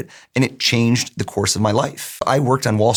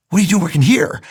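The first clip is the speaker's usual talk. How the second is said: mock surprise; 7 semitones higher, 4 dB louder than usual. Boss reacts in retold anecdote.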